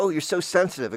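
high sing-song mocking tone. People mocking a sensitive child, high teasing. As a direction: tease it up high and sneering. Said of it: "And they go".

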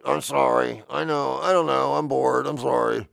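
mumbling excuses like a guilty boss; contrite Lord. Acted. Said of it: "a contrite Lord".

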